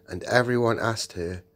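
plain reading voice

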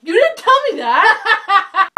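high, loud, slow laughing complaint; real laughing outrage at her sister; laughing protest. Real, not acted. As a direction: cry it out through a laugh.

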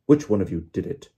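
loud, snapped accusation. A narrator's reading. Official accusing the children, snapping. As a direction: snap the question at them. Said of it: "he snapped".

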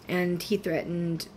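usual speaking voice

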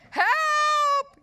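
very high, loud long yelp; hammed-up drowning shriek. A narrator's reading. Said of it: "yelped Ralph, spluttering and flailing".

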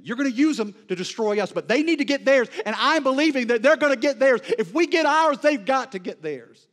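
loud, high, impassioned preaching that slows to drag out each shaken line; preaching at full force. Acted. Preacher voicing the prophet's fear to the congregation, full-force preaching. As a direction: preach it like the storm is already here.

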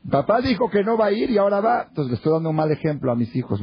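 high-pitched imitated indignation; indignant protest. Brother imagining his children catching dad backtracking, indignant. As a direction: mimic a scandalized reproach, pitch well up.

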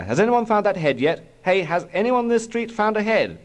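high-pitched call down the street. A narrator's reading. Policeman father asks the street for a head, calling out. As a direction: shout round for an answer, voice pitched right up.

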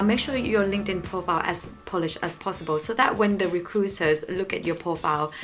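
ordinary talk, nothing marked

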